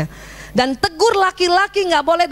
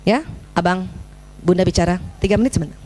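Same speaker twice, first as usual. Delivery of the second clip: gentle mother's call; 7 semitones lower than usual. Shown as a demonstration. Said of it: "kita tegur". (panggilan lembut seorang ibu kepada anaknya)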